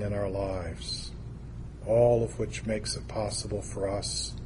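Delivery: soft, low, measured prayer. Real, not acted. Preacher praying to God with the congregation, hushed prayer. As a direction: pray quietly, with long reverent pauses.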